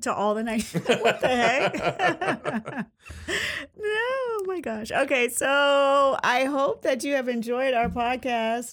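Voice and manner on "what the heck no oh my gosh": breaking into high-pitched laughter; laughing. Real, not acted. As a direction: laugh helplessly through the words. Cracks up mid shout-out.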